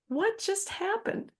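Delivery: high, slow stunned question. Retold or imitated. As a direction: ask it like you can't believe what just happened. Walking out stunned by the reprimand.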